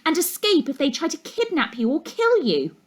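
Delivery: high, primly voiced girl; a hint of a prissy know-all. A narrator's reading. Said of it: "a prim girl in the front row".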